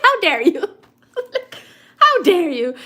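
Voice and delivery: high, loud hammed-up indignation; mock outrage. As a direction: act scandalised, with a laugh breaking in. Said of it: "I'll be mad".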